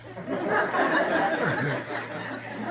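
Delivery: bursts into real laughter; a full, open laugh at herself; hearty laugh that rises at the end; loud laugh. Real, not acted.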